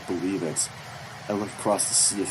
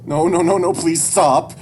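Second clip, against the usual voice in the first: loud, high, frantic begging; panicked pleading; 10 semitones higher, 13 dB louder than usual. Acted. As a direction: beg urgently at the top of your voice. Child pleads as rifle moves.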